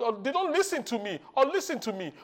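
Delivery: ordinary talk, nothing marked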